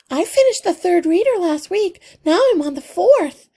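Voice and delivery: put-on a little girl showing off to her hero; eager young girl. A narrator's reading. Girl proudly reports her reading.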